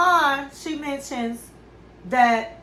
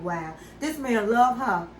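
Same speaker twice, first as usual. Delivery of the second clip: normal talking voice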